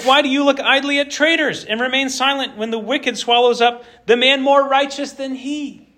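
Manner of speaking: high, pleading complaint to God; indignant Habakkuk. Retold or imitated. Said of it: "He's like".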